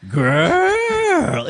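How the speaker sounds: very high, long drawn-out mock "girl"; hammed-up, stretched slang imitation; exaggerated "girl". Host showing the annoying way, high drawn-out "girl". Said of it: "when you go".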